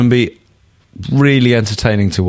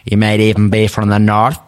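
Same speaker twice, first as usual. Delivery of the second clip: put-on northern Irish accent. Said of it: "tour of Ireland".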